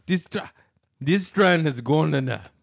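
slow, high, loud bad Irish; hammed-up failed Irish attempt; botched Irish accent. Shown as a demonstration. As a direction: struggle through an Irish accent, breaking off. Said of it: "Say this".

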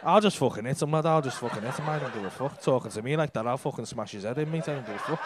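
low, muttered threats in someone else's head; comic simmering rage, pitched well down; mock fuming. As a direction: imitate a seething punter grumbling to himself. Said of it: "you look fucking fuming there".

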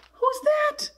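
very high, startled who's that; a very high astonished question; surprised. Acted. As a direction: squeak it out in surprise. New quack heard.

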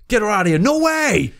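high, rising alarmed protest; outraged refusal. Retold or imitated. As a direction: shoo her out in disbelief. Others rejecting her, retold.

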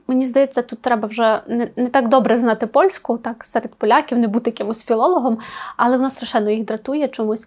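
ordinary talk, nothing marked

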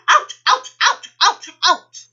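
very high, loud repeated yelps; high staccato shouts; shouting in pain. A narrator's reading. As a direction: yelp each word like a plucked emu. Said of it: "clutching his bottom and shouting".

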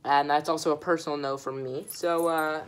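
ordinary talk

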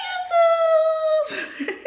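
high, drawn-out YouTube intro shout; It's Bambi. Retold or imitated. Borrowing another YouTuber's intro to greet viewers, high and loud. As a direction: squeal it out like a vlogger's intro. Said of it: "I got that from It's Bambi".

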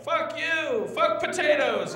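high-pitched mock heckler yell; hammed-up shouted heckle; imitated crowd yelling. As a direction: yell it like a rowdy audience member. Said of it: "people were just yelling out".

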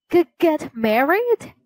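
high, startled stammer on get married; stammering surprise. Acted. Mother shocked by her frog son, stammering in surprise. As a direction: trip over the words in shock.

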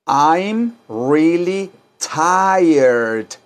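very slow, drawn-out teacher model; slow model for repeating. Shown as a demonstration. Teacher to students, drawing out each word slowly. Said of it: "Díganlo".

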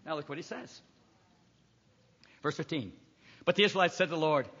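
normal talking voice

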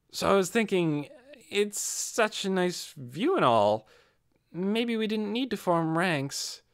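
put-on high, slow, offhand young man; lightly voiced Lacroute. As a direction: drawl it out idly, trying to break the silence.

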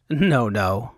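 plain reading voice

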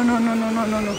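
normal talking voice

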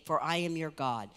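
usual speaking voice

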